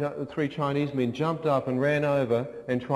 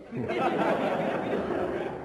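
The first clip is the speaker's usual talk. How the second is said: breathy laugh. Real, not acted. Laugh after story beat.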